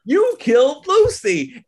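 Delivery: high, loud and slow accusation; accusing yell. Retold or imitated. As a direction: spit out the blame. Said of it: "and she's like".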